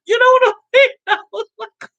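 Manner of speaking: high squeal into laughter; half mock cry, half laugh; tearful laughing. Real, not acted. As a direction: push it out high and loud, cracking up. Mock crying turns to laughing.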